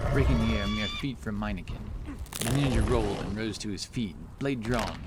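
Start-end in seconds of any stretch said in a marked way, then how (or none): none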